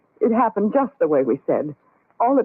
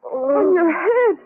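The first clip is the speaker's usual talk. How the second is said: horrified gasp; 9 semitones higher, much slower than usual. Acted. Sees the wound in horror.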